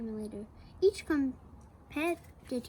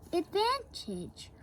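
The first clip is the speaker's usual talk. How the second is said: Mike voice; 5 semitones higher, slower than usual. Acted. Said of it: "said Mike".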